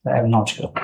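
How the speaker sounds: soft, hesitant admission; quietly unsure, a little embarrassed; nervous formal interview. Real, not acted. Candidate unsure under questioning.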